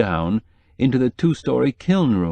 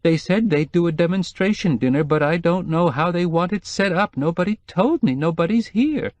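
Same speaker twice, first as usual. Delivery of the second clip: whimpering; 8 semitones higher than usual. Acted. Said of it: "she was whimpering".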